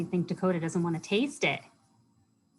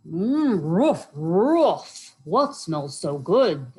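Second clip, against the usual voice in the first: loud, drawn-out doggy voice; woof, then ask slowly like an eager dog; big friendly barking dog; dog character; 7 dB louder, much slower than usual. A narrator's reading.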